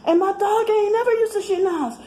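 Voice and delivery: put-on high, animated girlfriend gossip voice; sassy friend voice. A friend on the phone complaining about perps, in a high sassy voice. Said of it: "they might t-, tell me".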